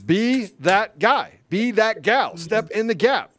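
loud, high preacher-style exhortation; rousing call to action. Real, not acted. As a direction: fire up the listeners to step in. Rallying call to join fight.

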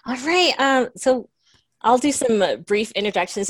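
ordinary delivery

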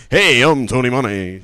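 loud radio DJ greeting; Tony Monaco. Retold or imitated. Showing friends what radio voice means, loud DJ impression. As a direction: punch it out like an FM host. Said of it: "listen to, like, Z one oh three dot five".

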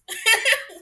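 sudden high loud laugh at herself. Real, not acted. Guest laughing about fasting only once, high laugh. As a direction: laugh at your own failed attempt.

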